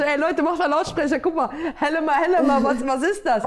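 high, giggly retelling of making fun of a caller; laughing mockery. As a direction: crack up while showing friends the funny gibberish. Retold stoned mockery on speakerphone.

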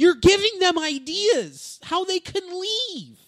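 high, loud, slowed plea; high pleading shout. Retold or imitated. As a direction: beg him to stop, voice up high. Retold plea to the host.

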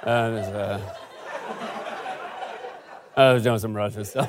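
low, quiet, hesitant mumble; low sheepish Manafort. Pretend. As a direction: mumble an embarrassed excuse. Manafort answer, impression.

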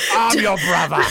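loud, hammed-up British; British accent. Twin brother introduces himself to Jesus, loud mock British. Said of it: "his, his British twin brother".

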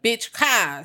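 high, loud bird caw; mock attacking bird. Host imitating birds that impale victims, mock attacking bird. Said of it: "they be like".

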